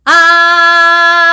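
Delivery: long high loud sustained ah; loud vocal exercise. Shown as a demonstration. Therapist showing a voice exercise, loud sustained ah. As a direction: belt a big projected ah. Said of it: "like, look at this".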